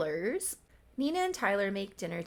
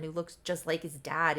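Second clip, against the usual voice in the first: ordinary talk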